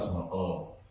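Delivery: low, soft imitated male viewer. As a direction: drop it low as an objecting viewer. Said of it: "I got male viewers. And they're like".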